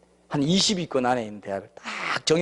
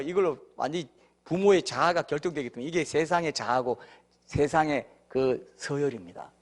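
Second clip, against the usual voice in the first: ordinary talk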